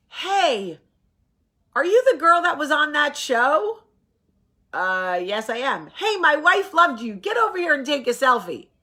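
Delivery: high, loud, thrilled fan voice; excited cop. Retold or imitated. Cop asks about her show, excited high shout.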